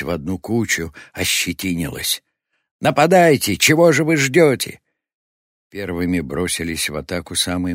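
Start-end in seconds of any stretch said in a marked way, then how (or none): 2.8-4.8 high, loud impatient command; urging battle cry. A narrator's reading. New leader drives the mob to attack, impatient shouting. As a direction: bellow at hesitant followers to charge.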